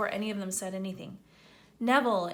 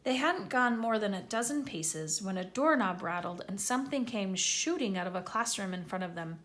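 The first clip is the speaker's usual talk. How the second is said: usual speaking voice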